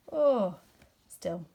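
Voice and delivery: plain reading voice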